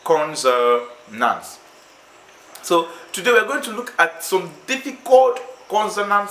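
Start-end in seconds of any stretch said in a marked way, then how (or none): 0.0-1.6 "consonants" drawn out, lower in pitch; slow syllable-by-syllable answer. Shown as a demonstration. Teacher answering "what?" for the class, slowly syllable by syllable.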